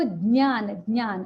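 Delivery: ज्ञान as "dnyaan", then "nyaan"; no g sound. Shown as a demonstration. Teacher repeats the right form, ज्ञान as "nyaan". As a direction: say ज्ञान with a nasal ny, no g. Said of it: "वहां पर ग का कोई स्थान नहीं है".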